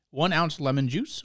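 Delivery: ordinary talk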